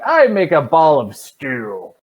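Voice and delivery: loud, drawn-out mock proclamation; mock player declaration. Game master mimicking an overreaching spell request, mock declaration. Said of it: "You can't be like".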